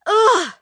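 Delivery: high, loud frustrated ugh; a boy groaning at being told to keep a secret. Acted. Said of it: "thought Ollie".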